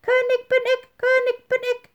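very high piping cry from the hole; tiny bird crowing muffled from below; high thin wren voice; fine voice. A narrator's reading. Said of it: "rief mit seiner feinen Stimme".

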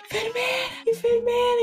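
high-pitched mock yell at reduced volume; retold scream. Woman alone in the corridor calls the nurse, mock scream. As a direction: imitate screaming for help, voice thrown up high but held back. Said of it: "E aí eu comecei a gritar".

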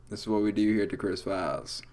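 ordinary talk, nothing marked

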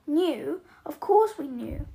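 loud, scornful outburst; shrieked. A narrator's reading. Aunt Petunia rounds on Harry, loud and scornful. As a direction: fling it back at him, loud. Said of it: "shrieked Aunt Petunia".

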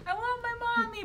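put-on high little-kid plea; childish whine. A scared child on her first school day, high and pleading. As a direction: play a scared seven-year-old. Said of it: "I was like".

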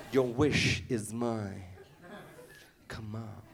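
lightly voiced genie, low and slow; slow booming genie line, half laughing. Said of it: "I don't mean genie like".

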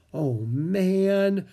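usual speaking voice

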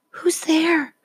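frightened breathy whisper. A narrator's reading. Franklin in his shell asks the dark shadows, scared whisper. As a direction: whisper so the shadows won't hear. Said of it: "whispered Franklin".

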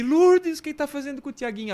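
high, loud alarmed call; high shrieking neighbor, mock alarm. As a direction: neighbor rushing in to stop it. Neighbor rushes to intervene.

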